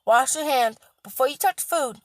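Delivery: ordinary delivery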